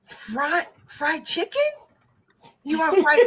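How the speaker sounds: high, tentative questions; retold baffled checking; puzzled guessing. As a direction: guess uncertainly at garbled words. Puzzled guessing at student's words.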